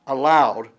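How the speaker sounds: "Aloud" punched out very loud; loud, stressed "Aloud" with falling tune; emphatic. Shown as a demonstration. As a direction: hit "Aloud" hard as the key word. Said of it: "the emphasis is gonna be".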